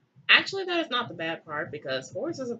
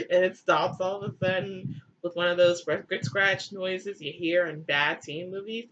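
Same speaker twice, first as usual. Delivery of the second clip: normal talking voice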